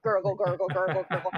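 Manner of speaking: laughing through a mock gurgle; drowning gurgle. Witch drowning in the fountain, mock gurgling. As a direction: gargle it like she's underwater.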